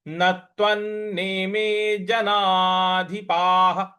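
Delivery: नत्वन्नेमे जनाधिपाः said slowly, syllable by syllable, with an aspirated ध and a final breathy ह echo; slow careful model of नत्वन्नेमे जनाधिपाः; Sanskrit pronunciation demo. Shown as a demonstration. Teacher slowly models जनाधिपाः for students to repeat. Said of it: "ज न परा की मात्रा".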